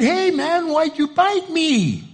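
high-pitched, drawn-out protest; acted wounded disbelief, voice way up; upset bitten hiker. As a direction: cry out in hammed-up dismay. Bitten hiker confronts the snake.

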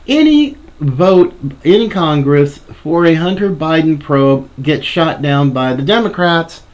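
ordinary delivery

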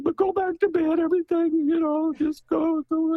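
high motherly hush-you-back-to-bed voice; dramatized mother. Retold or imitated. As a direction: voice the mother shooing her child back to bed. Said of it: "pardon my, uh, characterization".

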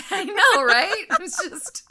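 high, loud, giddy gush; squealing fangirl delight. Real, not acted. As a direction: swoon over him out loud. Gushing over the exec hero.

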